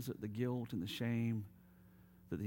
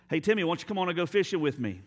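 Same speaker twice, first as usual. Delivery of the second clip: hearty invitation; 9 semitones higher, 11 dB louder, faster than usual. Acted. Grandpa calling Timmy fishing.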